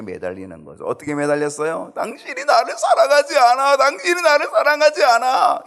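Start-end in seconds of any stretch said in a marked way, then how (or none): 1.9-5.6 high, loud whiny pleading; wife's whining plea. Acted. Samson's wife nagging him to prove his love, high whine. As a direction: whine it way up high, twice over. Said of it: "이렇게 얘기한 거예요".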